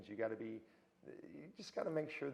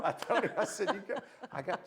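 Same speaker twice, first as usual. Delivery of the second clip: louder, higher, through laughter; laughing; 9 semitones higher, 9 dB louder than usual. Real, not acted. Guest joking about offering the star show tickets, laughing. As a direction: crack up while telling the joke.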